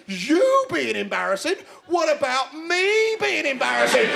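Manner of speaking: slow, rising disbelief on YOU and ME; indignant friends. Acted. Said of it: "And their friends say".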